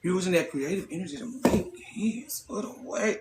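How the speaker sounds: breathless whisper, voice dropped right away; a hushed whisper aside. Real, not acted. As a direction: drop to a secret whisper.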